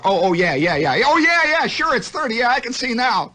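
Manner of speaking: high blustering agreement; father faking it. Retold or imitated. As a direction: pretend to see it, covering up. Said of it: "The old man is faking it already".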